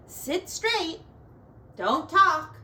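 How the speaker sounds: a hint of a strict teacher; stern teacher. A narrator's reading. Teacher telling the class to behave, mildly stern. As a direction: order the class to sit still. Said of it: "telling the class".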